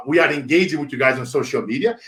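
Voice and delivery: ordinary delivery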